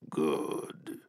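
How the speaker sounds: low, amused machine growl. A narrator's reading. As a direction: rumble it like an armored giant. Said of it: "said the giant, amused".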